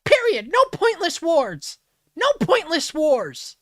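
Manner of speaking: high, loud cartoon demon mocking the slogan. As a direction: squeal No pointless wars like a little demon. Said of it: "He sounds like a demon".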